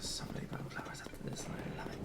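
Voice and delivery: breathy whisper. Real, not acted. Quietly gloating over bomb flowers.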